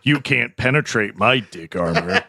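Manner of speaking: lightly voiced anime villain, low and smug; a hint of a gloating cartoon villain. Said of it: "You sound like an anime villain".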